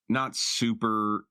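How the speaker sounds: ordinary delivery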